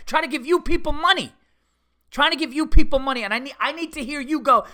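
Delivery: high, loud indignant outburst; angry rant. Real, not acted. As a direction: protest at full tilt, pitch jumping up. Ranting at the receptionist in anger.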